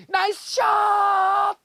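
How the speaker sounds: high, very loud, slow stretched cheer; long drawn shout. Pretend. Golf mom cheering a shot, long loud drawn-out shout. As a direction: hold the cheer across the course.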